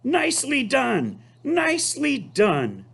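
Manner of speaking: high, excited honking goose voice; high bright goose cheer; goose crying out. Acted. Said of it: "cried the goose".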